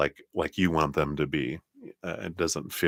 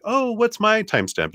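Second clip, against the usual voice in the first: high-pitched mock viewer question; viewer asking; 3 dB louder than usual. Returning viewer asks for their timestamp, high mock voice. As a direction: pipe up high as a curious viewer.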